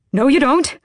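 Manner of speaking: loud, high-pitched protest; alarmed cry. A narrator's reading. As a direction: cry out in panic, pitch leaping. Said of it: "the man cried".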